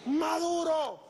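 high, drawn-out call; high-pitched mock yell; retold cry. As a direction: imitate a woman calling out from a crowd. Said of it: "Se para y grita".

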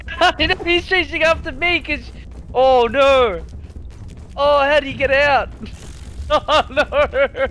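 being chased. Real, not acted. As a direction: laugh nervously while running away. Fleeing the ant, laughing.

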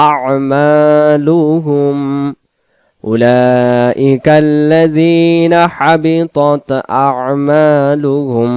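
slow melodic tajweed chant, long held vowels; chanted Quranic recitation. Shown as a demonstration. Teacher recites the verse.

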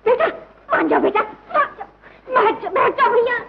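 high-pitched, anxious begging; urgent pleading. Acted. Family begging Mohan to calm down, urgent pleading. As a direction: plead with him to give in. Family begs him to stop.

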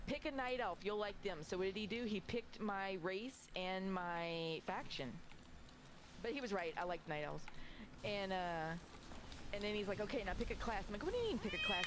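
ordinary talk, nothing marked

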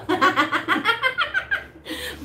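high bright burst of laughter; real laugh. Real, not acted. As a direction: laugh freely at the memory.

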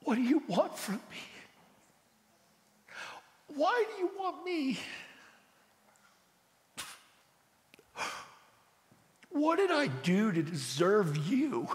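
high, soft crying-out to God; anguished plea. Retold or imitated. Crying out to God, soft and desperate. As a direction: ask God why he wants you, soft and aching. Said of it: "I'm like".

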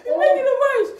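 high teary laugh; crying with laughter. Real, not acted. Laughing crying during challenge.

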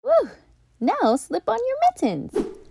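a high, delighted whoop; bright cheer, voice leaping up; excited cheer. A narrator's reading. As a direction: celebrate the finished mittens. Triumphant finish.

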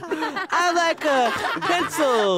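high, very slow mock voice; Mister Lynch. Boy mocking teacher as classmate, high slow impression. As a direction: drag it out high like the odd kid. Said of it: "Mister Lynch as the other kid".

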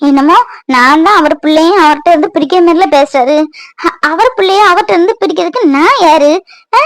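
ordinary delivery

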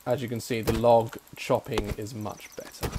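ordinary talk

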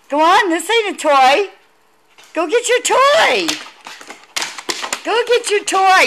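high, eager sing-song urging; coaxing dog talk. Real, not acted. Owner urging the puppy to fetch, high and coaxing.

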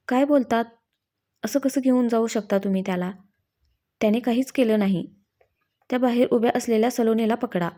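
ordinary delivery, nothing marked

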